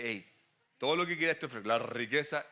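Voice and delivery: low-pitched tempter making an offer; low devil voice. Acted. The devil offers you riches, low devil voice. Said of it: "siempre le ponemos la voz baja".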